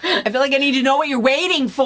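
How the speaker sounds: high, loud and laughing complaint; comically fed up, pitch and volume up; mock exasperation. Listener baffled by the accent of an audiobook, mock exasperated.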